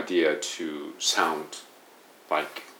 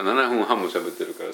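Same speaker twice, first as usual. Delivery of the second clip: ordinary talk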